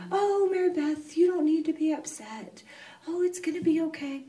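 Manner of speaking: high, sing-song comforting voice; mock soothing. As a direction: imitate a friend patting you. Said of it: "Nobody could say".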